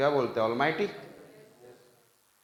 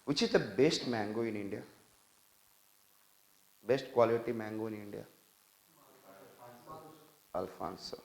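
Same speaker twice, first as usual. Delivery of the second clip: normal talking voice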